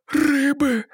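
put-on high, childlike babble; babbling mermaid plea. A narrator's reading. Mermaid babbling to her captor for fish. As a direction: babble it like a helpless sea creature. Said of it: "пролепетала она".

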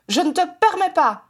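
high, outraged objection; indignant. A narrator's reading. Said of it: "s'indigna Edmund". (indignation vive, voix aiguë)

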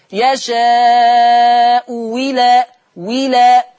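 يشاء إلى, hamza turned into a kasra waw, very slow and loud; with ibdal. Shown as a demonstration. Teacher demonstrating ibdal, hamza to waw, in يشاء إلى. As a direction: chant يشاء وِلى slowly, hamza changed to waw. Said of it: "يبدلها واو مكسورة".

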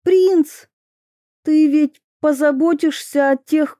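high, pleading, near tears; begging her to stay, voice cracking; cartoon princess. A narrator's reading. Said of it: "Голос принцессы сорвался".

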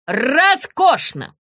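rolled, drawn-out high exclamation; yelling delight. A narrator's reading. Director cheers the fallen actress, drawn-out mild yell. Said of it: "завопил Гриша".

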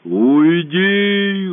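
loud, otherworldly growl of a command; monster voice. A narrator's reading. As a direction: send him away. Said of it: "раздался голос в ответ".

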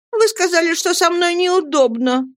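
put-on high, childish complaint; accusing young wife. A narrator's reading. Dora throws husband's words back, high complaint. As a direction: accuse him in a high pout.

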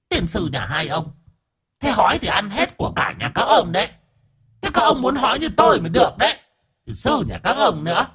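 high falsetto scolding, sharp and quick; sour-tongued ghost. Acted. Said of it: "Con quỷ bóng lại quay ra chanh chua". (giọng ma the thé, chanh chua)

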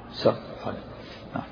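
ordinary delivery

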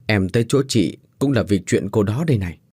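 usual speaking voice

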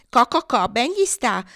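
high, loud cackling echo; cluck, then squawk the gloat; clucking hens. A narrator's reading. Hens echo the rooster's gloating, high clucking. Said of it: "gli fecero eco le galline".

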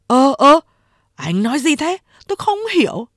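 high-pitched puzzled henchman; baffled protest. A narrator's reading. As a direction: play innocent, voice rising high. Henchman feigns not understanding.

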